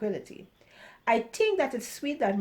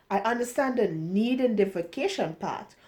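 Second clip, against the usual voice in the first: ordinary delivery, nothing marked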